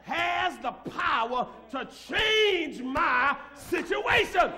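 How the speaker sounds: loud, slow, ringing preacher's shout; full-force preaching. Acted. Pastor shouting his faith to the congregation, full-force preaching. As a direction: preach it to the rafters.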